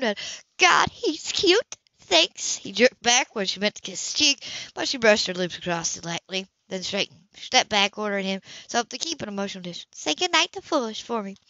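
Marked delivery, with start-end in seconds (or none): 0.6-2.6 much higher, slow, doting; delighted and touched; gushing. A narrator's reading. Anna beaming at sketch. 10.0-11.4 higher, gentle social worker voice; a light lift for the kind social worker; lightly voiced woman. A narrator's reading. Anna voiced by narrator.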